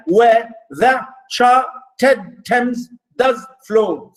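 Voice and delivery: very slow, every syllable set apart; syllable-by-syllable reading. Shown as a demonstration. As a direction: chop the verse into separate beats. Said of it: "So that's how we do it".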